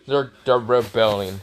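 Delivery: ordinary delivery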